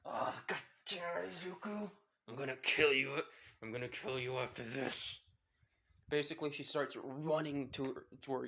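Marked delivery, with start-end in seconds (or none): 0.1-5.3 exasperated cursing. A narrator's reading. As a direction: curse him in exasperation. Character curses Izuku, threatens him.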